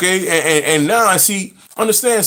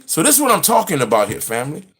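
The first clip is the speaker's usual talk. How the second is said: normal talking voice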